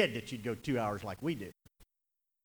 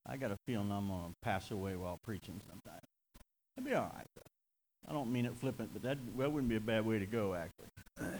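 quiet aside; 3 semitones lower, 7 dB quieter than usual. Real, not acted. Aside about dying while preaching.

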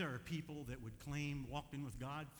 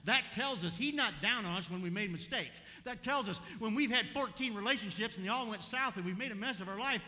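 loud, raised, driving preacher delivery; full-force preaching; 7 semitones higher, 9 dB louder than usual. Real, not acted. Preacher telling church he's not down on us, full-force preaching. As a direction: preach it hard to the congregation.

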